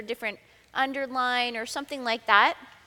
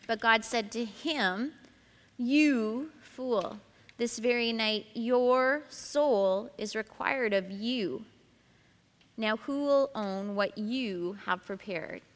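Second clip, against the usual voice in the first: slow reading, "him", "you" and "your" drawn out; stress on marked words; slower than usual. Shown as a demonstration. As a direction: dwell on each "you" and "your". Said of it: "emphasize the words that I would mark".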